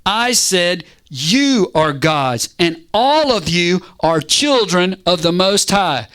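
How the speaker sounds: slow, weighty scripture reading; deliberate, drawn-out proclamation; emphatic declaration. Acted. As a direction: stretch out each phrase as God's pronouncement. Reads God's reply emphatically.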